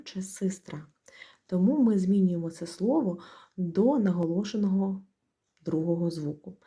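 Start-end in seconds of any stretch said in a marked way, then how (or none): 0.0-0.9 soft unvoiced систра, as the doubtful form; whispered wrong spelling. Shown as a demonstration. Teacher whispers the wrong variant систра. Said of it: "де ми можемо плутатись, як правильно написати".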